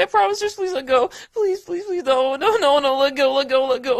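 high, loud desperate gasping okays; panicked pleading. Acted. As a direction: beg frantically, voice up high and loud. Begging to be let go.

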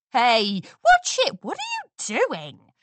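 loud high annoyed yell. A narrator's reading. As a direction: shove back at the crowd. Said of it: "ponies yelled".

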